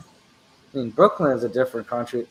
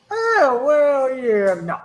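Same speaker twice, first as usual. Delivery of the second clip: much higher, louder mock voice for his speech; imitating Robert Walker; 13 semitones higher, 6 dB louder, much slower than usual. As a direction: squeak it out high like a nerd who surprises you. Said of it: "it's like".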